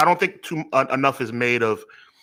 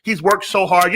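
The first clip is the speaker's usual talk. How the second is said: higher-pitched mock sympathy; mocking imitation; 6 semitones higher than usual. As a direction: parody someone pleading for a wrestler. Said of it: "You're never gonna hear me talk like that".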